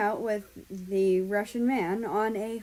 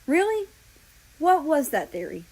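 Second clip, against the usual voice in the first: uncaring; 7 semitones higher, 5 dB louder than usual. Acted. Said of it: "not really caring about the answer".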